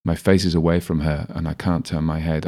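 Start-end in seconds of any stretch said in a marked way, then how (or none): none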